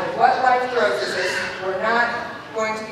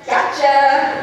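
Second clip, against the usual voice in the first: put-on kid's gotcha; 3 semitones higher, 7 dB louder than usual. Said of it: "Samantha goes".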